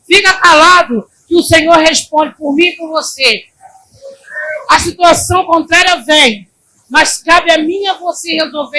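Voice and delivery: loud, high, fast sermon cry; preaching at full force. Acted. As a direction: drive it home to the church. Pregação exaltada. (pregação exaltada e alta)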